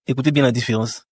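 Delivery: ordinary talk, nothing marked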